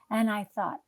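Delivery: normal talking voice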